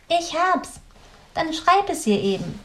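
viel höhere, aufgeregte Stimme; triumphierendes Grünäuglein. A narrator's reading. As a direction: ruf den Einfall hell heraus. Grünäuglein's idea. (Grünäuglein, hoch und triumphierend)

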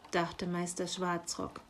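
usual speaking voice

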